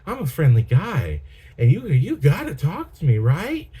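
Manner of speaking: high, singsong mock-friendly voice; hammed-up friendly pitch, rising at the end; overfriendly guy. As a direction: imitate a guy trying too hard to chat, pitch swooping up. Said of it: "he's just trying to talk like a".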